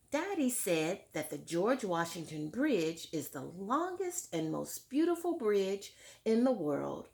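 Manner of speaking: clear, fuller read-aloud voice to the class; story narration. A narrator's reading. Teacher reading Tar Beach to her class, lively read-aloud. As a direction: read it out like a picture book for children. Reads book text aloud.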